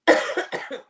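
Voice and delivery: a sharp loud cough. Real, not acted. Nurse showing viewers she coughs when excited, loud cough. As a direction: cough once, hard. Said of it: "You see?".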